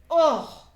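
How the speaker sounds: high, loud frustrated "oh"; exasperated cry. A narrator's reading. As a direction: burst out in exasperation. Marjorie's exasperated outburst.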